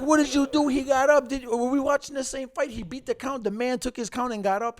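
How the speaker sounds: mock outrage at the referee; angry friend at ref. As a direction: yell at the ref in his friend's high, worked-up voice.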